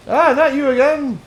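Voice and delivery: loud, high cry of disgust; exasperated outburst. Acted. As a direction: blurt it out, fed up and loud. Character exasperated at a captive.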